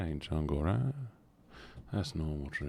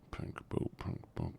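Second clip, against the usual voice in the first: 1 whispered repeat; 7 dB quieter than usual. Real, not acted. Repeating a misspoken phrase.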